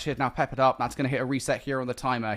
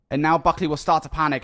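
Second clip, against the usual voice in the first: ordinary talk, nothing marked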